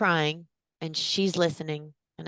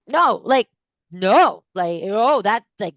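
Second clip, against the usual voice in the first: higher, louder flustered refusal; flustered protest; 3 semitones higher, 8 dB louder, slower than usual. Retold or imitated. Refusing her friend's dinner invitation, flustered and loud. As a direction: sputter out a rattled no. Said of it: "And I was like".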